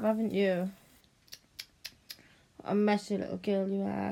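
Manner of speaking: ordinary talk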